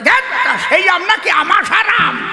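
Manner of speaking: high, loud startled shout; shocked shout. Acted. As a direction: yell it in disbelief. Patient shocked. (রোগী অবাক হয়ে চেঁচিয়ে ওঠে)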